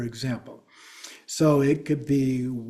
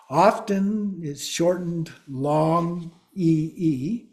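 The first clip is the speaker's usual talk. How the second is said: ordinary talk, nothing marked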